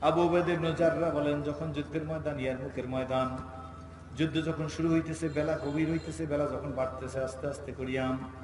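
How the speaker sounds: low, soft melodic narration; sermon storytelling. A narrator's reading. Preacher recounting the dusty battlefield of Yarmuk, soft chanted storytelling. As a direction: tell it low, drawing out the phrase endings.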